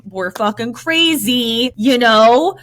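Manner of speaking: menacing. Retold or imitated. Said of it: "if he would have been like".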